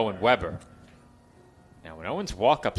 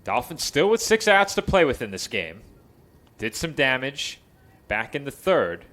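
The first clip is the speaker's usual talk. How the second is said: raised, projected baseball call; live play-by-play; 5 semitones higher, 6 dB louder than usual. Real, not acted. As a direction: call the game with punch on key words.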